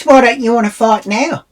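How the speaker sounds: high, loud imitated challenge; goading challenge. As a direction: mimic a woman daring someone to fight. Acts out woman goading her to fight.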